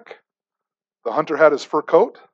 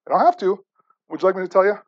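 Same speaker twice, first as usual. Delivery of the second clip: ordinary talk, nothing marked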